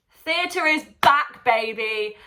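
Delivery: whooping theatre is back; triumphant cheer. Real, not acted. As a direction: shout it like a victory cry. Celebrating theatre reopening.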